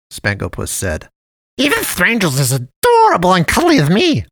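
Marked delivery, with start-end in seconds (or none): 1.6-4.2 high, louder cartoon cat voice; sugary high cartoon voice; jolly cat man. A narrator's reading. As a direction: play the friendly dump cat, overly sweet. Cat man flatters boy.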